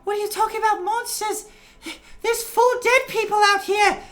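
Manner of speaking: very high, loud squeaky gnome voice; shocked bystander. Acted. Little gnome in the crowd yelling at the party, high and loud. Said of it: "somebody, like, leans out and is like".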